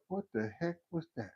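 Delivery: very soft, dazed question; stunned hush. Retold or imitated. Sitting alone in his room, stunned and hushed. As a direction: breathe it out alone in shock. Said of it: "just like".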